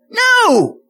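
high, drawn-out, exclaimed "no"; emphatic refusal. Acted. Pastor rejects a silly idea of enjoying pain, emphatic refusal. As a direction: reject it with a loud, high burst. Rejecting his own mock quote.

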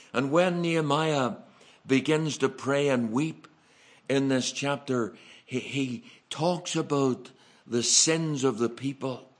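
loud, ringing sermon build; full-force preaching. Real, not acted. Preacher driving home Nehemiah's prayer, loud and rising.

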